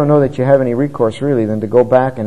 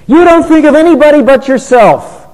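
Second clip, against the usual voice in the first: harsh accusation; 12 semitones higher, 7 dB louder than usual. Retold or imitated. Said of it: "now that's harsh".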